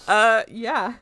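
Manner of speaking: loud, laughing through the words; laughing yeah. Real, not acted. To friends, still laughing after the joke. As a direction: still laughing as you answer.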